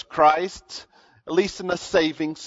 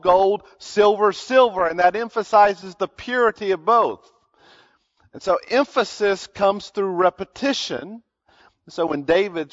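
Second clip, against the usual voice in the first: usual speaking voice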